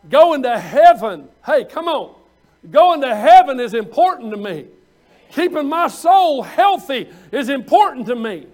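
loud, ringing declaration; fervent preaching. Real, not acted. Preacher proclaiming to the congregation, loud and fervent. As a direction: shout your conviction to the church.

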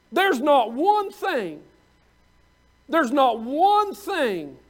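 "one" leaned on, slow and deliberate; stress on one. Real, not acted. Preacher insisting to the congregation, slow with "one" stressed. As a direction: hammer the word "one" slowly. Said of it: "repeated emphasis".

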